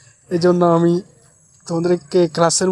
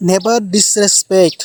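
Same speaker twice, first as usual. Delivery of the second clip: slow model phrase; 5 dB louder, much slower than usual. Shown as a demonstration. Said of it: "teacher models English phrase".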